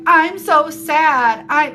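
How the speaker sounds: slow, loud over-the-top pity moan; mock melodramatic sad. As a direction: ham up being so sad, slowly. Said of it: "This, what it looks like is like".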